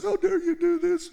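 high whining mock outrage; high-pitched mocking protest; whiny complaint. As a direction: whine at Moses like spoiled kids. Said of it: "They're screaming and yelling at Moses".

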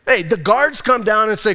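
ordinary talk, nothing marked

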